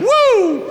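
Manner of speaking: high loud party whoop. Pretend. Party cheer.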